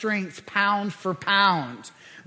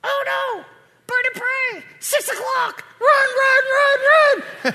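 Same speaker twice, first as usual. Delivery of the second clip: high-pitched panicked lemur shouts; yelling while running away; 4 dB louder, slower than usual. Pretend. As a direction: squeal the alarm like a fleeing lemur. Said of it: "Something like this".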